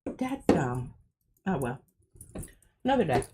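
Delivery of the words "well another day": ordinary talk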